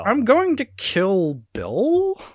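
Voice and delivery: high, very slow frog-puppet delivery; Kermit the Frog voice. Acted. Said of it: "giving her Kermit the Frog voice".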